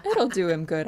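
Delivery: laughing through the words; amused laughing line. Acted. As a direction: giggle it out. Laughing about husbands.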